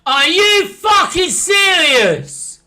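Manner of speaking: outraged yell at the ref; furious shout. Real, not acted. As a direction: roar it slow and loud. Shouting at screen.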